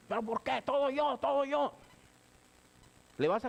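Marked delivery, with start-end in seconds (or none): 0.1-1.7 much higher whiny protest; sulking teen. Retold or imitated. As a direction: complain like a kid who always gets the no. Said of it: "no puedes ir".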